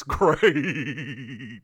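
stretched, wavering sung-out ending; langgerekt. Real, not acted. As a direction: draw the word out long with a wobble. Drawn-out wobbly word for effect.

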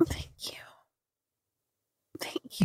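barely breathed thanks, choked up; tearful whisper. Real, not acted. Whispering thanks to a friend after her tribute. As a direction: whisper it through tears, overwhelmed.

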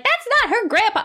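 hammed-up chorus voice; mocking correction. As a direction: squeal it like everyone correcting you. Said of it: "everyone's like".

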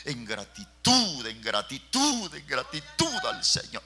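"gratitud" hit loud and slow each time; slow emphatic build. Real, not acted. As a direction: lean hard on every "gratitud", slowly. Repeated phrase, preaching climax.

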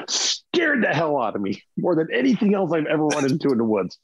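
ordinary talk

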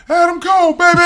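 high, loud wrestling catchphrase yell; shouted catchphrase. Retold or imitated. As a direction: belt it like a hype man. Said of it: "and said".

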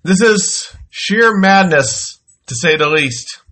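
ordinary talk, nothing marked

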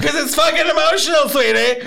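very high, tearful-sounding wail; hammed-up weepy explanation to a child; mock emotional outburst. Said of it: "He's like".

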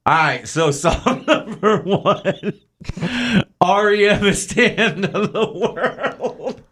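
giggling through the words; can't stop laughing while reading. Real, not acted. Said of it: "say that again without laughing".

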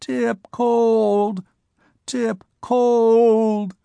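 high wailing little creature voice; frightened wail. Acted. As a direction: wail it out, scared and lonely. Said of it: "a frightened, lonely wail".

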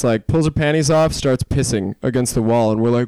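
ordinary talk

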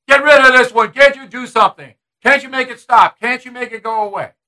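playing the boss ranting at his lawyer; imitating Trump venting. Host acting out Trump ranting at his lawyer, exasperated. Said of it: "vented to McGahn".

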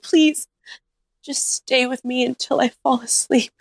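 high, imitated needy begging; hammed-up tearful request; mock pleading. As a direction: plead in a high voice, mimicking him. Drunk crying man begging, acted out.

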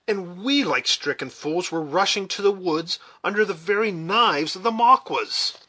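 high, loud, agitated outburst; exclaiming. A narrator's reading. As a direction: exclaim in alarm at the mistake. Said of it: "exclaimed Hawkeye".

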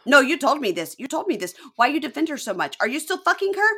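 hammed-up, high screamed tirade; screaming meltdown. Jealous girlfriend screaming at boyfriend, hysterical. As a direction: escalate from angry accusations to all-out shrieking. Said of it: "traumatized my puppy with my screaming".

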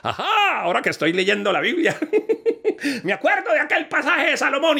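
high laughing judge; judge's idea. Acted. The judge remembers Solomon, high and laughing.

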